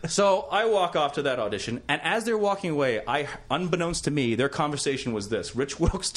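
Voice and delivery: normal talking voice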